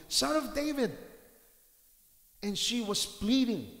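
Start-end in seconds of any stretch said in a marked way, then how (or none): 0.1-1.0 high, loud cry; shouting. Retold or imitated. Woman shouting to Jesus over the crowd. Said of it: "crying out over the top of her lungs and shouting".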